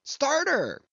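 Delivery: very high command to row; high-pitched rallying cry. A narrator's reading. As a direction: call the order out high over the boat. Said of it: "cried Stubb".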